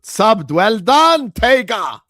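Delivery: loud, high shout of praise; big rousing cheer; excited cheer. Real, not acted. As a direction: shout out congratulations to a viewer. Cheering a new subscriber.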